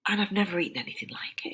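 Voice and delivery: hushed, awed whisper; a quick whispered confession of delight; whispered quote. Acted. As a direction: breathe it out in wonder, fast. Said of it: "he says".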